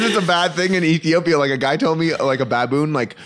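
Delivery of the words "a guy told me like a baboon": ordinary delivery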